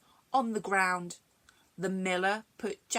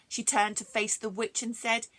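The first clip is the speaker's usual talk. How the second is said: plain reading voice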